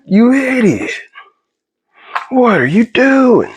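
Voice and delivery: high-pitched, drawn-out self-reproach; mock self-scolding. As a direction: stretch it out high, scolding yourself. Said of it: "And I was like".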